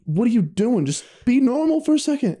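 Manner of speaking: high-pitched comic exasperation; worked-up, high-pitched complaint; exasperated plea. Retold or imitated. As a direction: plead in mock disbelief, pitch shooting up. Mock exasperation at friend's voices.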